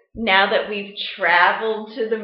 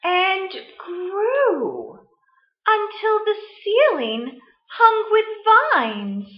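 story reading; 7 dB louder than usual. Acted. Teacher reading Where the Wild Things Are to the class, storytime voice. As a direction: read it like storytime, letting big moments swell. Teacher reads picture book aloud.

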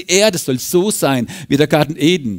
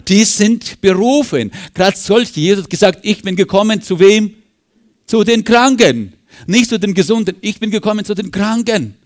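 loud, high fervent preaching; raised revival preacher voice; 6 semitones higher, 5 dB louder than usual. Acted. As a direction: preach it out to the congregation. Preacher at full force.

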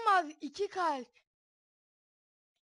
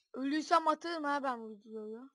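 ordinary delivery

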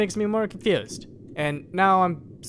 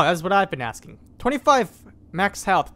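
ordinary talk, nothing marked

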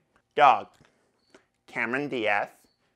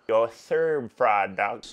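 ordinary delivery

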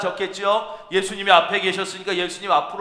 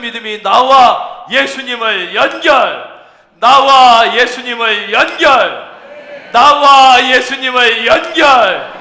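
slow, loud repeats growing louder; building proclamation; 6 semitones higher, 11 dB louder, slower than usual. Real, not acted. Preacher urging connection with Jesus, building to a shout. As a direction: hammer it out, louder with each repeat.

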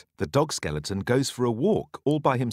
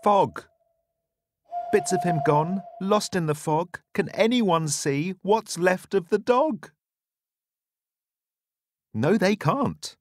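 spooky storytelling; 7 semitones higher than usual. A narrator's reading. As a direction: tell it like a ghost story for kids. Spooky fog passage.

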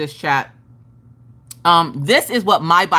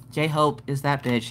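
slow, low, softer deadpan; monotone; 5 semitones lower, 6 dB quieter, slower than usual. Shown as a demonstration. Showing how not to greet the viewer, slow monotone. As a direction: drone it without any hype. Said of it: "I don't care how monotone".

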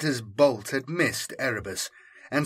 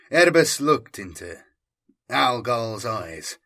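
usual speaking voice

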